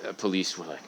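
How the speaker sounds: ordinary delivery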